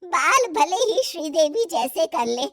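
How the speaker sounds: normal talking voice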